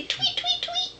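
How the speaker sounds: high laughing bird chirp; bird tweeting. Pretend. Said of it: "They say".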